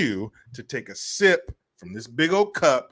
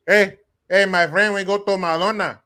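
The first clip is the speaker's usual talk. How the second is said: louder, higher mock Mexican accent; imitated Mexican accent; 6 semitones higher, 7 dB louder than usual. Migrant inviting a friend to McDonald's, imitated Mexican accent. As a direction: call out in a put-on Mexican accent. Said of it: "that's how they say it".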